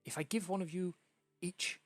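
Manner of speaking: fast, excited stammering impresario; lightly voiced flustered theatre manager; excited hostess. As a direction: babble the plan out breathlessly. GM voicing frantic hostess.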